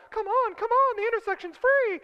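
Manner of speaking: very high, squealed cartoon arrow voice; talking traffic arrow. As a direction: egg the driver on in a high falsetto. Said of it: "and the arrow's going".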